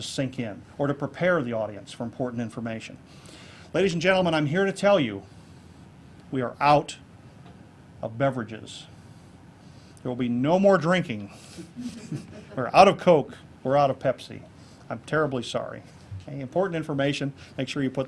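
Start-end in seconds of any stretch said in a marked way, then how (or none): 3.7-11.3 grave announcement with long pauses; dramatic pauses. Shown as a demonstration. Speaker demonstrating dramatic pauses on a mock announcement. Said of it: "did I give that more gravity than it probably deserved". 12.6-14.4 a touch of mock solemn stress on out; mock gravity. Shown as a demonstration. Speaker keeping up a mock grave announcement, stressing out. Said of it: "That did it for comedic effect".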